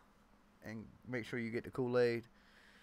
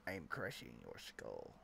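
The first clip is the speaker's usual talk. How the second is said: very deep, quiet monster growl; barbarian; 16 dB quieter than usual. Pretend. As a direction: growl it low like a brute crushing a skull. Mock skull-crushing growl.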